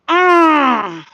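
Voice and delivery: a loud, high, pained mm; pained loud groan. Real, not acted. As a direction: groan out in disgust. Reacting to the rope story.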